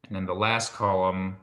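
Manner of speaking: ordinary talk, nothing marked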